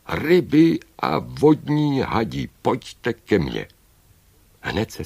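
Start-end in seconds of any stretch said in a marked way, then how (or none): 0.1-3.7 slow, high, loud call; heroic summoning call. A narrator's reading. Old woman calls sea creatures to her, heroic shout. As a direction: summon them across the wide sea. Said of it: "zvolala bohatýrským hlasem".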